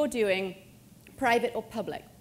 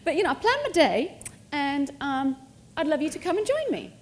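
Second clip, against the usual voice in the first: eager invite; 5 semitones higher, 5 dB louder than usual. Retold or imitated. Voicing own date invitation.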